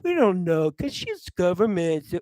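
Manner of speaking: lightly voiced lazy drawl; mild mocking voice of complacent people; mock quote. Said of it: "people are like".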